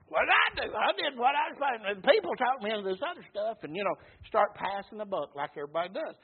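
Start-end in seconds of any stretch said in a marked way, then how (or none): 0.1-1.9 high-pitched mock protest; acted self-justifying whine; defensive excuse. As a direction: plead innocence in a higher voice. Saul acted making excuses.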